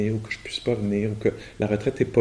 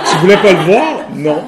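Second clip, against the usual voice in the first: much louder, higher, laughing through the quote; laughing mock question; 8 semitones higher, 15 dB louder than usual. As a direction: imitate friends' question, cracking up. Friends' question retold laughing.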